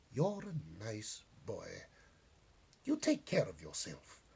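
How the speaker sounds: put-on slow, fond goodbye to the boy; old lady softly saying goodbye to young knight; tender farewell. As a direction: send him off gently, like a grandmother.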